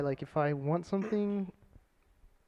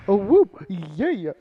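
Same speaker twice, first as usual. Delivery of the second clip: mock hype man; 4 semitones higher, 11 dB louder, slower than usual. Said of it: "I'm never gonna".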